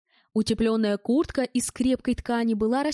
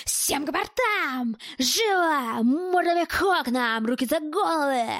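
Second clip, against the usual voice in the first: slow, strained, higher-pitched shouted orders; frantic yelling; 4 semitones higher, slower than usual. A narrator's reading. Bald masked gunman ordering hostages to the windows, yelling. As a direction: yell drawn-out commands with menace, held back in volume. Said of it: "истошно закричал".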